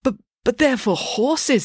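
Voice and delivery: high, slow stammering protest; panicky stammer. Acted. Joe panics.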